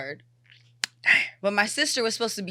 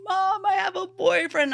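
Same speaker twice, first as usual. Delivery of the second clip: high, loud tearful confession; imitated bawling; 13 semitones higher, 5 dB louder than usual. Daughter confessing boyfriend to mom, mock bawling. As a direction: wail it out, hammed-up. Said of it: "So I'm bawling my eyes out".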